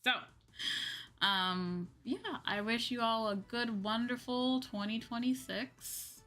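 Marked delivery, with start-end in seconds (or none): none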